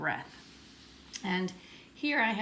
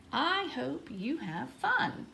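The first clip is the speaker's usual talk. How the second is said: higher, slow and expressive; heartfelt exclamation, lilting up on each word; read with feeling; 6 semitones higher, much slower than usual. Shown as a demonstration. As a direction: stretch it out with lots of feeling. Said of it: "Let's read it with a feeling".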